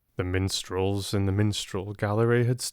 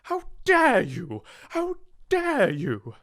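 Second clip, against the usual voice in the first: slow, much higher, furious; outraged. Acted. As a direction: spit each word in outrage. Enraged headmistress scolding girl.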